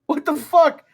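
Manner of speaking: high, loud imitated shock; mock shocked outburst. Imitating Rainville after getting punched, loud high shock. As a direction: play his partner's stunned reaction. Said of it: "he's just like".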